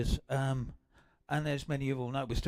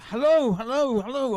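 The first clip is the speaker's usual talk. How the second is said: loud, high eager greeting; excited hello; 8 semitones higher, 8 dB louder than usual. Real, not acted. Greeting a long-lost relative he has just heard about, excited. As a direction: call out hello with real excitement. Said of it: "I'm excited".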